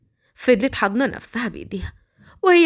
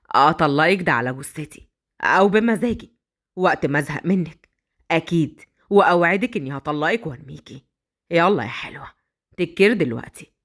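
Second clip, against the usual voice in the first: menacing kidnapper; 6 semitones lower than usual. Acted. Badr threatening the captive girl, low and smug. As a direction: savour his power over her.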